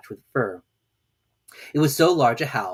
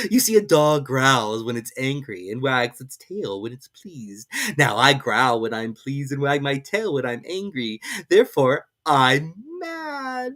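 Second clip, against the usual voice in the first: slow, drawling cat voice; Cheshire Cat; 3 semitones higher, 4 dB louder, slower than usual. A narrator's reading. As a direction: toy with the girl lazily.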